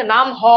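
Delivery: নাম হ with a separate "ho" after "naam"; visarga sounded out. Shown as a demonstration. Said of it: "বিসর্গের উচ্চারণ হচ্ছে হ".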